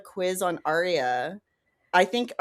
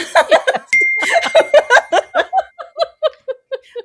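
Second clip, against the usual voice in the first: high, loud laugh; 8 dB louder than usual. Real, not acted. Quiz contestant cracking up after answering, loud high laughter. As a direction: burst into a long, high laugh.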